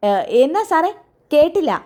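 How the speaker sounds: high-pitched deaf tea seller asking again; deaf old shopkeeper. Acted. Deaf tea shop owner voiced. (ചെവി കേൾക്കാത്ത ചായക്കടക്കാരൻ്റെ ഉയർന്ന ശബ്ദം)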